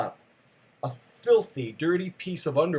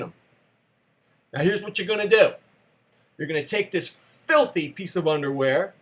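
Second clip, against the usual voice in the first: stern lecturing father; 2 semitones higher than usual. Retold or imitated. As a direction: lay down the law to your son.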